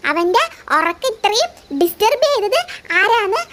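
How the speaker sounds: slow, soft storybook voice; bedtime story reading. Acted. Brother reading a bedtime story to his little sister, slow and soft. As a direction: read gently to lull her asleep.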